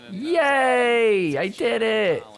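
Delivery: high-pitched little mock celebration; mock childlike cheer. Host voicing player who finally got net cord, mock cheering.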